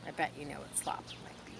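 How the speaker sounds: ordinary delivery, nothing marked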